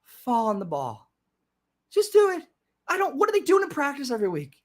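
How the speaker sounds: high-pitched exasperated disbelief. Real, not acted. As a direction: plead in frustrated bafflement. Exasperated at team's mistake.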